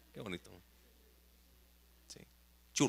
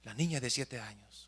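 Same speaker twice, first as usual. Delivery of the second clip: ordinary delivery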